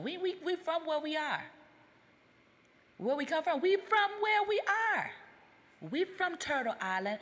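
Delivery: exasperated outburst. Real, not acted. Berating Caribbean people about their origins, rising exasperated shout. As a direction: build it up, exasperated and incredulous. Heated rant, voice climbing.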